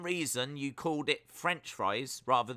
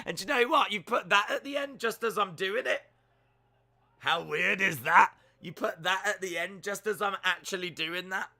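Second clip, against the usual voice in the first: higher and louder, thrilled at the coincidence; giddy disbelief, talking fast and high; excited; 6 semitones higher, 3 dB louder than usual. Real, not acted. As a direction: gush about the perfect timing, voice up high. Amazed chatter timed a comment.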